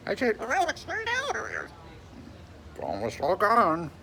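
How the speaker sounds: high slow cooing. Real, not acted. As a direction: coo slowly at the pet. Cooing to a pet.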